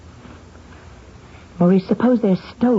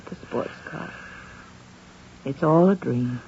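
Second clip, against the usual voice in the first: dazed murmur; 10 dB quieter than usual. Acted. Alone at night, dazed.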